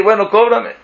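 más alto, ya exasperado, bueno, cóbrame; que se note que ya lo pidió muchas veces; insistencia impaciente. Retold or imitated. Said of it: "y le vuelvo a pedir". (pidiendo por enésima vez, con impaciencia)

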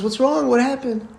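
high-pitched, lightly voiced mother asking; mock-worried mother's questions. Mother woken by screaming child.

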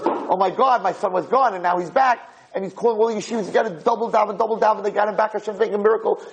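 high, excited imitation of an overjoyed father; urgent rallying cry, pitch held high throughout; going crazy. Father calls yeshivas about his revived son, frantic excitement. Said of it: "Everyone's going crazy".

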